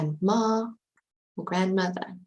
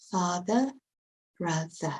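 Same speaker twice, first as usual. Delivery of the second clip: British pronunciation. Shown as a demonstration. Teacher showing it in British, r-less endings. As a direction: drop the final r in "Father" and "brother", British style. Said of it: "In British, a".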